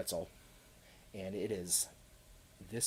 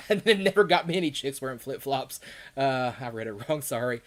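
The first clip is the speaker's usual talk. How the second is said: laughing; 4 semitones higher, 9 dB louder than usual. Real, not acted. Laughing at own misreading.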